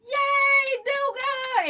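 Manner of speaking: high sung-out cheer; cheering yell. Retold or imitated. Mom cheering her dancing baby, high and loud. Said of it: "and I'm yelling".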